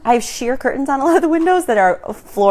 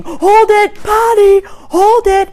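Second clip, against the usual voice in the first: put-on high, loud toddler yell; imitation of a small boy's urgent shouts; toddler shouting; 13 semitones higher, 8 dB louder, much slower than usual. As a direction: holler it like a kid embarrassing mom in public. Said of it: "have a toddler in your car shouting".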